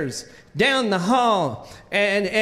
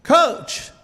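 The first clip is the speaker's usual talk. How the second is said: much higher-pitched, insistent call; 7 semitones higher, 3 dB louder than usual. Retold or imitated. Ignored kid's last try at the coach, insistent calling out. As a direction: imitate an ignored kid calling harder.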